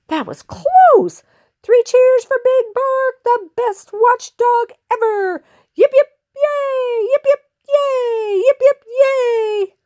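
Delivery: high, squeaky three cheers; jubilant crowd. Acted. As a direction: celebrate in a tiny crowd voice.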